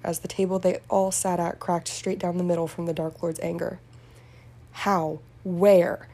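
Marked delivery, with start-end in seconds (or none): 4.7-6.1 sharp, loud angry demand; furious demand. A narrator's reading. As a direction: bark the questions in rage. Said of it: "the Dark Lord demanded answers".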